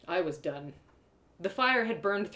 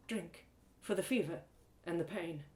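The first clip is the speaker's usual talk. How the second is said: soft healer voice; 6 dB quieter than usual. Acted. Iktuin offers the cup.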